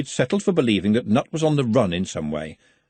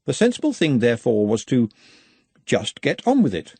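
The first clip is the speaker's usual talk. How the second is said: ordinary delivery, nothing marked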